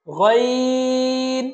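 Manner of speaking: গইন from upper throat, long level vowel; upper-throat articulation. Shown as a demonstration. Teacher models the throat letter, গইন drawn out. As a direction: chant গইন with a throaty first sound. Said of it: "কণ্ঠনালীর উপরিভাগ থেকে".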